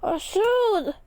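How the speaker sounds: high, loud alarmed shout; freaked-out yell. A narrator's reading. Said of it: "yelled Roy, freaking out".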